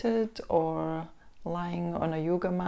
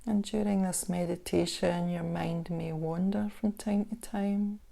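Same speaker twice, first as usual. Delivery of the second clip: unhurried body-scan guidance with long pauses; guided meditation; 5 dB quieter than usual. Acted. Instructor guiding listeners through a body scan meditation, calm guided meditation voice. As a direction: ease the listener into stillness, leaving space between phrases.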